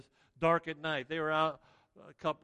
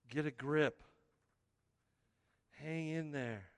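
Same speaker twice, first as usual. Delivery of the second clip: reassuring. Retold or imitated. Jesus reassures the disciples.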